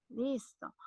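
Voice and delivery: usual speaking voice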